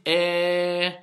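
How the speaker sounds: long held eh in place of i; son fautif. Shown as a demonstration. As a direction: sustain a slack eh vowel. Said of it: "Il y en a, ils disent". (son fautif eh, longuement tenu)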